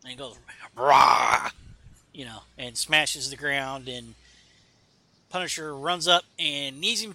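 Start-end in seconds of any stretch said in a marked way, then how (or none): 0.8-1.5 short furious roar, much louder; loud angry warrior roar; enraged roar. Acted. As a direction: bellow it like a raging god. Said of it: "he gets all ragey".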